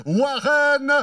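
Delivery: builds to a long, drawn-out shout on the name; announcer's big name call. Real, not acted. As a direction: stretch the rider's name out like a boxing ring announcer. Announcer presents rider to crowd.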